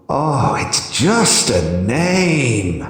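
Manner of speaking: slow, irritated drawl; irritable drawl. A narrator's reading. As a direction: drag it out, annoyed. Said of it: "the Hierophant drawled irritably".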